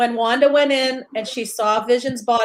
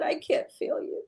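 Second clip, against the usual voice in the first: breathy whisper of a grieving widow's words; whispered quote; 13 dB quieter than usual. Retold or imitated. [name] whispering Wanda's words to the panel. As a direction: whisper it as if over his body. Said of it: "I said".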